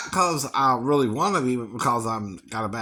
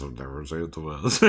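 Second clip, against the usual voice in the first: lower, softer and faster; quick low mutter; 9 semitones lower, 6 dB quieter, faster than usual. Shown as a demonstration. Singer muttering his repeat lower and faster. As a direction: throw it away under the breath. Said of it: "repeated line".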